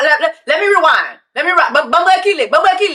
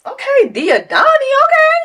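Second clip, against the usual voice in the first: puzzled; 9 semitones higher than usual. Retold or imitated. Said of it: "So I'm like".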